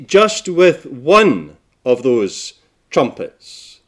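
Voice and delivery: low, hushed delivery with long pauses; solemn preaching. Acted. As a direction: let it sink in, soft and grave. Preacher drops into solemn reflection.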